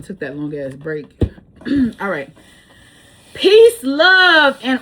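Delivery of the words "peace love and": high, loud cheerful farewell; big sign-off. Real, not acted. Signing off to viewers, loud high cheer. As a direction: belt the sign-off out happily. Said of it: "signature sign-off".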